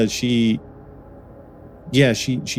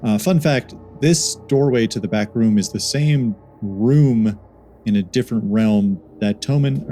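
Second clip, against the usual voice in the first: usual speaking voice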